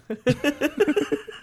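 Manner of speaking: high burst of laughter; cracking up mid-story; real laugh. Real, not acted. Teller laughing at his boss's switch.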